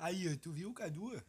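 slow, casual bro voice; imitating João Frango. Imitating João Frango for the podcast audience, slow and casual. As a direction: lightly lean into the streamer's drawl. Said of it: "imitar o João Frango".

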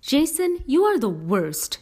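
name pitched very high, then dropping; high indignant outburst, short of a scream; exasperated yell. A narrator's reading. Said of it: "she yelled".

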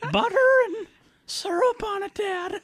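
put-on a nervous little kid serving Dad breakfast; child voice. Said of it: "your child voice sound like a creepy old man".

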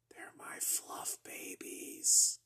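slow, hushed whisper. Retold or imitated. Telling her about the marshmallows in a slow whisper. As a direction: breathe it out slowly like a secret.

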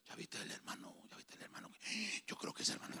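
hushed, secretive gossip; gossiping whisper. Acted. Preacher imitating someone gossiping about a brother, whispering. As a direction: whisper it like passing on a rumour. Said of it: "es que así habla uno cuando está chambreando, despacio".

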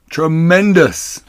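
high, loud, drawn-out cheer; jubilant shout. A narrator's reading. Badger thrilled at the cider find, jubilant shout. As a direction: shout it slowly with great delight. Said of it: "shouted Badger".

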